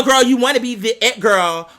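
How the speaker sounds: ordinary talk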